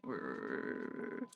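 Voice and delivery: very low, soft rolled growl; imitated annoyed growl. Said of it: "sounded really pissed".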